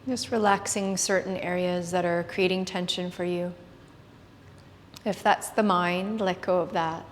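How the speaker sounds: hushed yoga class guidance; soft spa whisper. Acted. Leads a guided meditation.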